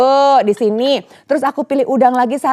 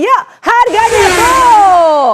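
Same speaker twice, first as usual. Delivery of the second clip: high, slow, loud price shout; excited shout; 8 semitones higher, 4 dB louder, much slower than usual. Real, not acted. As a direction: call it out to the viewers.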